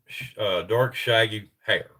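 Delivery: ordinary talk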